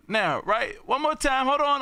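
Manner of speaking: ordinary delivery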